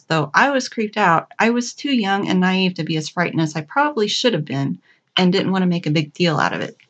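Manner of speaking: usual speaking voice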